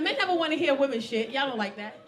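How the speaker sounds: ordinary talk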